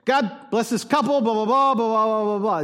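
tossing off a quick, empty blessing; lightly mocking his younger self's rushed prayer; rattled-off prayer. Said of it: "the college, the twenty-year-old [name] thing".

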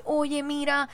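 a lightly voiced embarrassed clerk; embarrassed server. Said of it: "estaba todo así como apenado".

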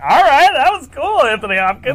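starstruck. Retold or imitated. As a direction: savour the moment, awestruck. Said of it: "I was like".

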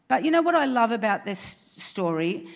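usual speaking voice

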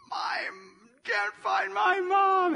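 loud, very high mock cry for help; hammed-up shout of a lost little kid; lost child's wail. Four-year-old lost in Target pleads at store front, hammed-up wail.

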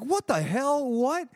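high-pitched, baffled questioning; retold disbelief. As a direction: act out total bewilderment. Retold disbelief at friend's boasts.

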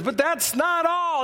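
high, showy teaser; hyped-up, high-pitched host voice; game-show announcer. Retold or imitated. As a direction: tease the next prize like a TV announcer. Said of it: "Jay would go on and goes".

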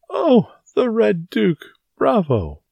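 high, drawn-out gleeful cheer; delighted cry. A narrator's reading. Porthos applauds Aramis's nickname for the cardinal, delighted. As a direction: crow with theatrical delight. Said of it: "cried Porthos, clapping his hands".

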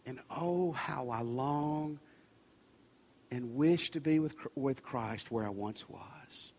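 understated ache, trailing low and quiet; retold wistful longing. Old hymn writer confesses his longing.

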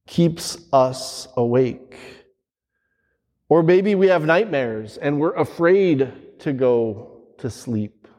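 ordinary delivery

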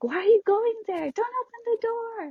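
high, yelling at the screen; mock horror-movie viewer. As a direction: warn the character in the movie.

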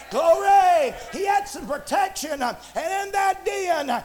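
fervent shouted preaching; full-force preaching. Real, not acted. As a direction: drive every phrase home to the congregation.

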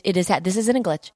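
normal talking voice

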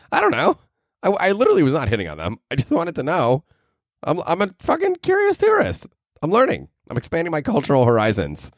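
high-pitched, squeaky protest; mock defensive. Curious tourist excusing himself to listeners, mock defensive.

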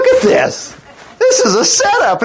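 very high, laughing exclamation; delighted excitement. Real, not acted. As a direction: burst out, tickled by the scheme. Preacher delighted at Naomi's scheme.

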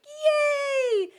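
very high, loud mock cheer; kids cheering. Kids cheering at the end of the school day. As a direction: squeal like happy kids.

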